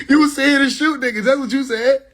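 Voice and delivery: teasing callout. Retold or imitated.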